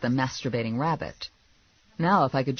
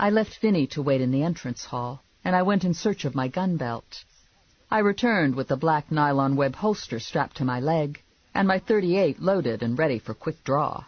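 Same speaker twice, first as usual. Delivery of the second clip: ordinary delivery, nothing marked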